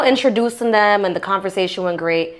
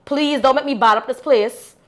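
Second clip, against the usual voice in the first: higher, mock-threatening Guyanese plea; Guyanese dialect warning; 5 semitones higher than usual. Wife warning her man in Guyanese dialect, mock threat. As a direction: warn him off in Guyanese Creole.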